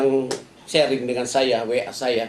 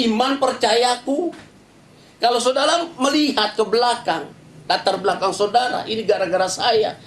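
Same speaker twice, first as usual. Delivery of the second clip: fiery preaching; 8 semitones higher than usual. Acted. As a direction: preach at full force from the pulpit. Preacher exhorts, voices prayer.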